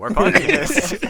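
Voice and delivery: loud, drawn-out announcer shout; mock trailer shout. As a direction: bellow it like a hype call. Shouted podcast demand.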